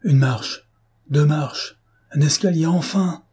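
rising cry of joy at finding the stairs; joyful exclamation. A narrator's reading. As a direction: build from hope to relief. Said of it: "Il eut une exclamation de joie".

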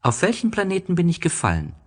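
put-on high, quick child's question; the little prince asking the snake, high and fast. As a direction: rush it out high like a bewildered small boy. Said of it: "fragte der kleine Prinz".

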